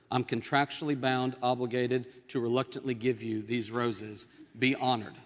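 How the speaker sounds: low, even, dutiful recital; deadpan husband with no feeling; stoic. Acted. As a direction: recite it like a contractual obligation. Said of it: "with a stoic look".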